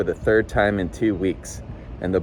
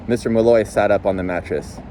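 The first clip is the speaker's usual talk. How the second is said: ordinary delivery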